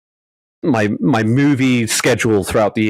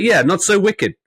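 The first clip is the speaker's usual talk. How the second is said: put-on light Boston accent; 6 semitones higher than usual. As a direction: say it like a Boston guy. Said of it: "thinking about that in a Boston accent".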